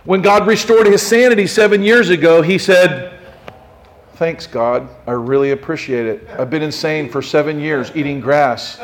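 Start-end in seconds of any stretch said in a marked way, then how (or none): 4.1-8.8 low-key, lower-pitched mild gratitude; comically understated appreciation; mock casual thanks. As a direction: thank God as if it's nothing much. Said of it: "Is that what he did?".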